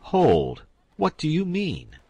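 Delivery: loud, high, dramatic command; hammed-up noble alarm; startled demand. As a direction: stop him short, then demand. Duke demands the secret.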